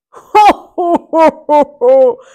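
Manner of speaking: loud booming giant laughter; thundering laugh. Acted. Giant laughing at Mickey's challenge, thundering. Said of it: "thundered the giant".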